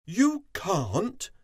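loud, high, incredulous question; outraged queen, loud and high; booming queen. A narrator's reading. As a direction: boom it in royal disbelief. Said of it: "she boomed".

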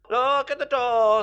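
high, loud crowing call; excited cockerel. A narrator's reading. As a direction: cry out like an excited rooster. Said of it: "said the cockerel".

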